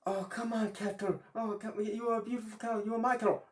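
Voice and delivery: high, delighted cooing over the cow; Little Klaus high and happy about the cow; gleeful boasting. Acted. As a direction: croon to the cow in glee.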